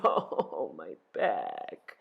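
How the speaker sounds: low, creaking groan. A narrator's reading. Ereshkigal groaning over her back, low pained groan. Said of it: "She groaned".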